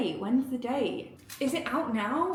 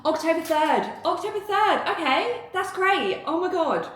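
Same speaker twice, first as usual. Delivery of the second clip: high, loud, thrilled announcing; real excited outburst; excited discovery; 7 semitones higher, 5 dB louder than usual. Real, not acted. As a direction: burst out with the date, high and loud. Finds out the release date.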